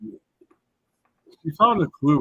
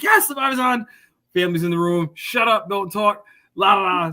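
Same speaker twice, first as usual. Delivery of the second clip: high, loud, excited shushing; hyped up for the show; 7 semitones higher, 5 dB louder than usual. Retold or imitated. Retold excited living room reaction.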